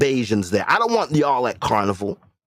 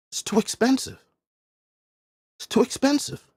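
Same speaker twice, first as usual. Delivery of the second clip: mock high complaint; 11 semitones higher than usual. Mimicking the common complaint.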